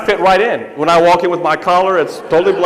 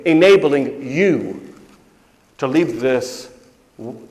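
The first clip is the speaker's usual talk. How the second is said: usual speaking voice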